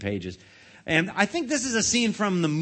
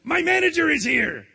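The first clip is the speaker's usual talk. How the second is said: much higher and louder, relieved; relieved cry; 10 semitones higher, 4 dB louder than usual. A narrator's reading. Krabs greeting his manager, higher louder relief. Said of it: "cried Mister Krabs with a sigh".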